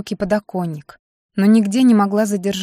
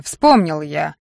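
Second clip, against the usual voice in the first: ordinary delivery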